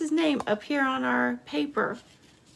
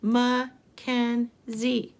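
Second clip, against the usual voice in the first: Mackenzie very slowly in three beats; slow, separated Mackenzie for counting; syllable by syllable; much slower than usual. Shown as a demonstration. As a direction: pause between each syllable of Mackenzie. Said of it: "Let's count the syllables".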